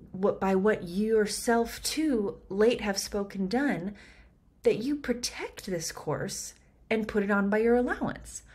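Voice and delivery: measured verse reading; Shakespeare reading. Shown as a demonstration. Reads the verse, slowed.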